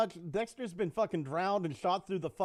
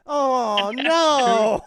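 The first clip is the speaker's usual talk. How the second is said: louder, higher mock dismay; hammed-up oh no; 6 semitones higher, 12 dB louder than usual. Mocking the husband's weak reaction, hammed-up. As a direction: stretch it out as a mock whine. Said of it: "It's just".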